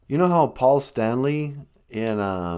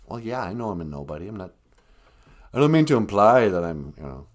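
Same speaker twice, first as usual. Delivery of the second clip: normal talking voice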